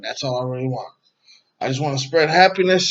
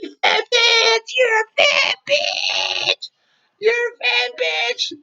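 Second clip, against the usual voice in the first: very high, drawn-out screechy imitation; mock screaming; much slower than usual. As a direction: mimic her screaming in a slow, piercing falsetto.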